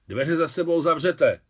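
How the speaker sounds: loud, high-pitched command; shouted order. A narrator's reading. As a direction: shout an order across the room. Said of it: "křikla Alexandra".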